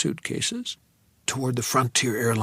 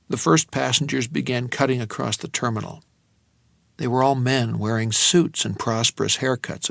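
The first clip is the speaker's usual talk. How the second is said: plain reading voice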